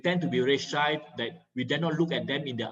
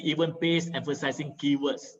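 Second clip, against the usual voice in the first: usual speaking voice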